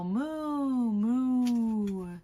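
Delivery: drawn-out mooing; cow moo. Acted. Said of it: "He can sound like a cow".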